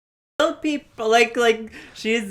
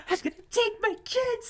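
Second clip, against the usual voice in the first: much higher, breathy and softer; high squeaky voice; 6 dB quieter than usual. Retold or imitated. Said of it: "Selena's on the phone, like".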